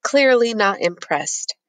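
plain reading voice

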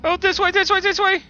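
calling out while fleeing; urgent loud directions, half laughing; being chased. Real, not acted.

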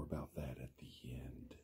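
secret whispered aside. Real, not acted. Whispering an aside to the children. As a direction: lean in and whisper it.

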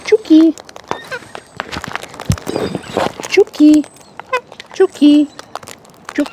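high sing-song chook call; calling chickens. Real, not acted. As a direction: coax the hens in a high voice.

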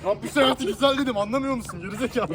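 loud irritated scolding; angry. Acted. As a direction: snap at them loudly. Acted angry outburst.

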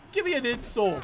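ordinary talk

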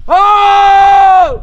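loud high shout across the valley; echo shout. Real, not acted. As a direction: yell out to hear the echo. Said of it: "Vou dar um grito aqui".

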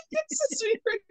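high, giggly, words squeezed through laughing; real laughter. Real, not acted. Storyteller remembering her shock in the cafeteria, laughing helplessly. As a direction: crack up while trying to talk. Cracking up mid-story at the memory.

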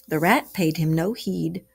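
ordinary delivery

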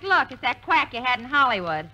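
ordinary delivery

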